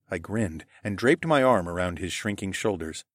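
usual speaking voice

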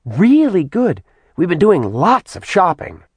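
put-on high-pitched, excited girlish demon; bubbly Simi demon. A narrator's reading. Simi gushing to Kat about shopping, high and chirpy.

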